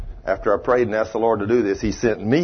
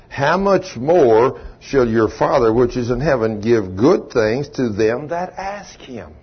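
King James reading to the congregation; scripture reading; 2 semitones higher, 3 dB louder than usual. Acted. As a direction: read the verses with weight. Reads Scripture aloud.

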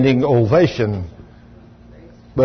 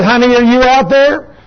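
lightly voiced wife, raised pitch; wife calling out; 8 semitones higher than usual. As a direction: imitate calling to a husband outside. Retold wife calling out the door.

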